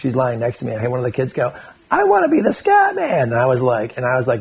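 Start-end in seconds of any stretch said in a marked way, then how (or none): 1.9-3.3 put-on much higher child's voice, eager; kid voice. A kid in the lodge says he wants the name, high child voice. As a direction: pipe it up like a little kid. Kid's voice quoted.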